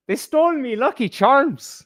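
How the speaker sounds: a high, hammed-up stage Irish accent; mock Kerry accent. Mocking the British idea of the Irish, in a hammed-up Kerry accent. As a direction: put on a jokey Kerry accent for the line. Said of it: "I was trying my best at".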